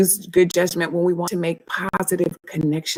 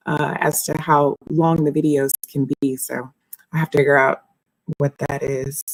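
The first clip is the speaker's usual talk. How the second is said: normal talking voice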